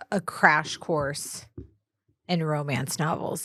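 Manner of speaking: ordinary talk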